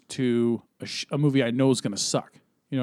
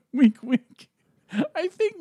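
laughing wink wink; 4 dB louder, slower than usual. Acted. Sly hint about Margot.

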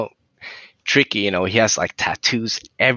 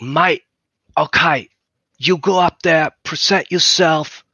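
higher, mock mate-to-mate pep talk; Tricky; 7 semitones higher than usual. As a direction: imitate a star backstage urging a rookie on. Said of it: "Tricky comes".

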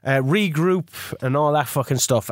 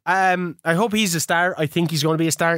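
usual speaking voice

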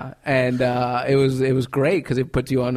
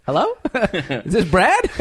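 laughing disbelief; 6 semitones higher, 5 dB louder than usual. Retold or imitated. Shocked comic on the phone, laughing disbelief. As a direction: laugh through the question in disbelief. Stunned by mentor's outburst.

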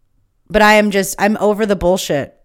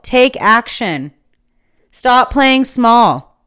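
slow, deliberate, higher rallying preach; rousing sermon close; 4 semitones higher, 5 dB louder, slower than usual. Acted. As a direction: lay each phrase down slowly like a closing charge. Slow rousing call to action.

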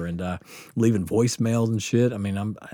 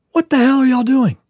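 imitating the angry doctor, much higher and louder; 9 dB louder than usual. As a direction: snap at the students like a furious little doctor. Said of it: "And he's so angry".

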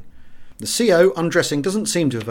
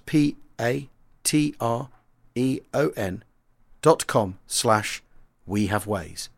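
spelt out slowly; slower than usual. Shown as a demonstration. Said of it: "spelling out the web address".

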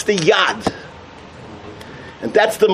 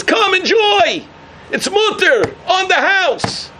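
high, slow coaxing call of the tempter; the evil inclination beckoning, slow and high; tempting Yetzer Hara; 11 semitones higher, slower than usual. Acted. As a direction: lure them in, slow and sweet.

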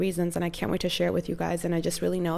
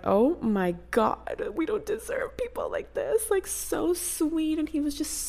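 breathy near-tearful hush, higher; choked-up whisper; 8 semitones higher than usual. Retold or imitated. Telling viewers about the sweet couple, teary whisper. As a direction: whisper it, close to tears.

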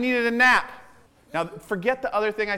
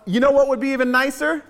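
eager idea; 5 semitones higher, 4 dB louder, faster than usual. Retold or imitated.

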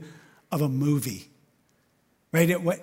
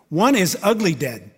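usual speaking voice

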